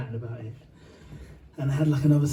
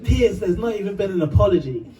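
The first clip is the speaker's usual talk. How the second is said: raised, louder protest; retold shout; 5 semitones higher, 5 dB louder than usual. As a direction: act out shouting at an interviewer in a dream. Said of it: "I shouted at Piers Morgan in my dream".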